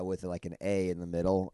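ordinary delivery

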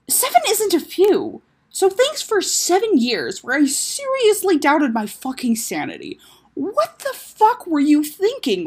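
high, loud, drawn-out indignation; angry outburst. Acted. Taco confronting his missing sister, furious. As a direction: lay into her, stretching the stressed words.

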